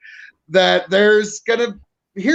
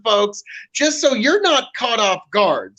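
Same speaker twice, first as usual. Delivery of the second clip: normal talking voice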